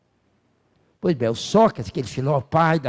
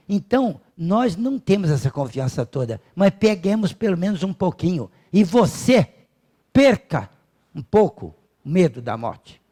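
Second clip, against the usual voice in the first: ordinary delivery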